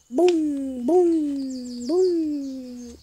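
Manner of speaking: big booming bear steps, loud; loud booming stomps. Acted. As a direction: boom out each heavy footstep. Bear footsteps sound.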